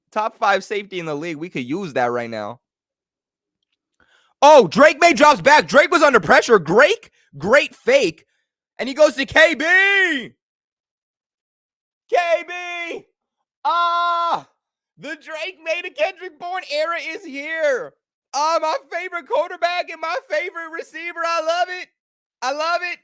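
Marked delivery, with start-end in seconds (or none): 4.4-14.5 high, loud, building to a shout; excited play-by-play. Real, not acted. Live reaction to the pass. 15.0-23.0 gushing fan. Real, not acted. Celebrating the completion.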